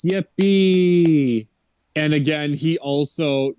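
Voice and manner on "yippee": mock-cheerful yippee, hammed-up; a fake little cheer; sarcastic yippee. Crowd mockingly cheering the non-talking horse, sarcastic. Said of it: "non-talking horse".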